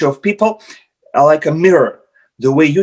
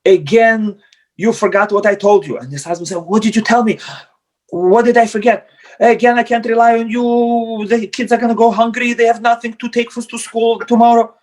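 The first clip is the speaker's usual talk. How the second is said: much higher, a mock scolding scream; hammed-up nagging at the husband; mock scolding wife; 7 semitones higher than usual. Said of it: "the wife screams at the husband".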